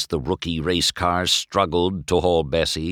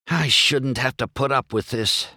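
mildly indignant, higher grumble; angry grumble; 7 semitones higher than usual. A narrator's reading. McQueen fed up with roadwork, grumbling mildly angrily. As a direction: grumble with a touch of anger. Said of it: "McQueen grumbled angrily".

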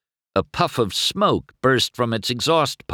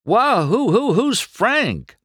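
very high, stammering alarm; high-pitched, drawn-out worried question; alarmed cry; 13 semitones higher, 3 dB louder than usual. A narrator's reading. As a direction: blurt it out in a startled panic. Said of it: "McQueen cried".

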